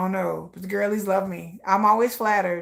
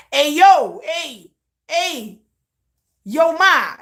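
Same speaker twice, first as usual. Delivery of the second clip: loud, high holler in bursts; catcalling; 8 semitones higher, 9 dB louder, much slower than usual. Acted. East Coast dude calling after a passing woman, catcalling. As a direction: holler after someone down the street.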